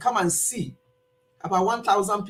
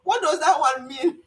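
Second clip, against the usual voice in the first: lightly acted, higher-pitched puzzled husband; retold husband's question; 6 semitones higher than usual. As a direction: quote a puzzled husband with a laugh in the voice. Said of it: "My husband said".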